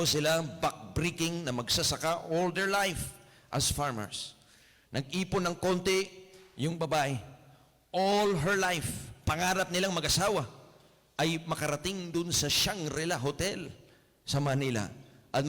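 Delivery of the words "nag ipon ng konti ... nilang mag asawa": usual speaking voice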